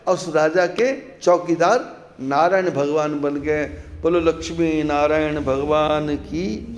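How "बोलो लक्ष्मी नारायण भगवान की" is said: slow, drawn-out, low-pitched call of praise; devotional acclamation. Real, not acted. Preacher leads congregation in closing praise, drawn-out devotional call. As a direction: stretch it out as a closing jai chant.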